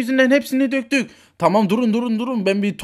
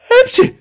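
high, loud sneeze; 10 dB louder than usual. Acted. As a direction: burst out in a squeaky sneeze. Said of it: "hepsi hapşırıyor". (hapşırma)

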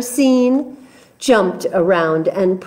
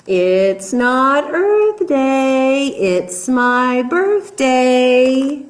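monkey drawing out each word, slow and playful; slow singsong monkey; 3 semitones higher, much slower than usual. Acted. Monkey teasing the jungle animals, slow singsong. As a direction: stretch the refrain out slowly, teasing.

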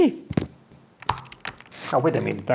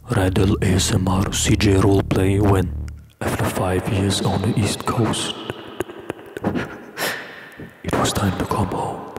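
ASMR voice; 7 semitones lower than usual. Pretend. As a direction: play the ASMR roleplayer, low and intimate. Reading ASMR roleplay request aloud.

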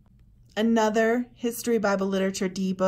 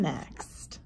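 mysterious whisper; 5 semitones lower, 9 dB quieter than usual. Acted. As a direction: whisper the last word like a secret. Teasing a mystery book.

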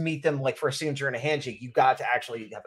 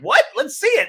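surprised; 12 semitones higher, 6 dB louder than usual. Retold or imitated. Reaction video surprise on waking.

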